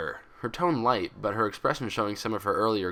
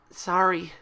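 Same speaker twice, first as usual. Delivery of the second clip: stammered apology; 11 semitones higher, 4 dB louder than usual. Acted. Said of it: "She stammered the apology".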